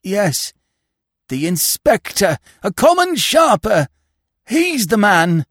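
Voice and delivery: high, distraught wife accusing; frantic accusation. A narrator's reading. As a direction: cry it out in agitation, rising to a shout. Wife's frantic accusation in the story.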